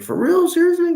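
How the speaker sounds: squeaky high, astonished disbelief; amazed disbelief. Retold or imitated. Young guy hears about zombies, amazed high disbelief. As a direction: pitch way up like you can't believe it.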